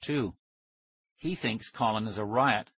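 usual speaking voice

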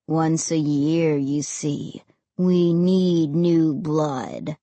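low, very slow ghostly drone; ominous dead boy. A narrator's reading. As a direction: draw each word out menacingly. Dead boy demands blood.

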